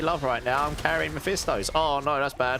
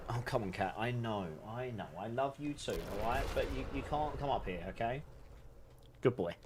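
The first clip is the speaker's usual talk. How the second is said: much softer, fond and reassuring; tender cat talk; 3 semitones lower, 12 dB quieter than usual. Real, not acted. Streamer softly reassuring his needy cat off-mic, tender and hushed. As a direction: tell the cat you love him but firmly, hushed.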